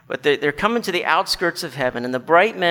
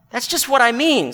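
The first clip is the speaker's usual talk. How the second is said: higher, emphatic retort; indignant ghost; 7 semitones higher, 3 dB louder than usual. Acted. Ghost seizes on the bright man's words, indignant. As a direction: jump in with a raised, aggrieved voice.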